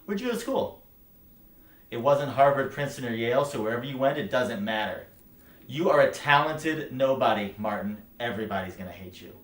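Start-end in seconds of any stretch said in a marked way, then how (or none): 0.0-8.0 loud, high-pitched dressing-down; forceful, contemptuous rant; scornful tirade. Acted. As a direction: fire it at them in a raised voice. Belittling the listener's background.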